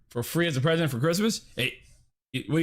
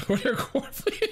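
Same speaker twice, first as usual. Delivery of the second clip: laughing question; 9 semitones higher, slower than usual. Real, not acted. Laughing at chat.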